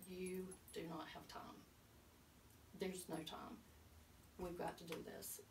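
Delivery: soft, deliberate urgency with pauses; grave warning. Retold or imitated. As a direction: quietly press the point home. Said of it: "looking me deep in the eyes".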